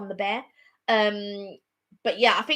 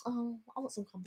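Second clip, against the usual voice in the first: much softer, wistful; softer wistful want; 14 dB quieter than usual. Real, not acted. Eyeing Samuel's crumble, soft wistful craving. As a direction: murmur the craving.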